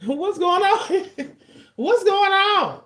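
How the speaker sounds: high, drawn-out laughing question; laughing mock disbelief. Acted astonished reaction, laughing.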